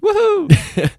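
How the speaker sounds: very high, loud woohoo; excited whoop. Retold or imitated. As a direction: cheer like a thrilled dad. Homer cheering.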